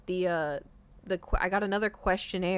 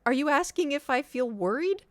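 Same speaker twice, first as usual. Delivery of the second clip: high, incredulous asking; baffled question; 6 semitones higher, 5 dB louder than usual. Retold or imitated. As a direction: ask it in disbelief. Baffled reply to nurse.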